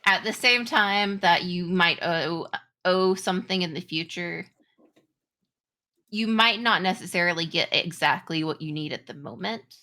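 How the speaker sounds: ordinary delivery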